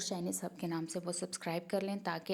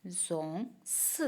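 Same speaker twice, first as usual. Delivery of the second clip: slow demo; 4 dB quieter, much slower than usual. Shown as a demonstration. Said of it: "brown colour को कहते हैं जोंग्स".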